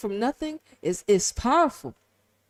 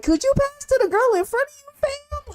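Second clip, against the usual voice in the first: mock exasperated teammate; 12 semitones higher than usual. Mocking a player on the pitch.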